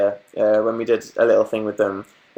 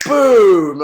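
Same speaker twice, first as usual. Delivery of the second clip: excited shout; 6 semitones higher, 9 dB louder than usual. Real, not acted. Exclaims at result.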